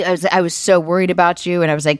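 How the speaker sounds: ordinary talk, nothing marked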